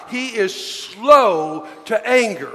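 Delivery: "slow" stretched out long; slow, deliberate line leaning on "slow"; stress on slow. Shown as a demonstration. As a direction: draw out the word "slow" before "to anger". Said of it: "we're gonna emphasize the word slow".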